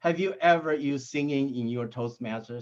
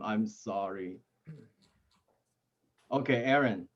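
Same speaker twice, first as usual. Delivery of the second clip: normal talking voice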